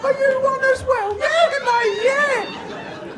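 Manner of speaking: tiny squeaky high-pitched geezer; little geezer. Acted. Little geezers greeting each other, very high squeaky voices. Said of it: "We're just little geezers".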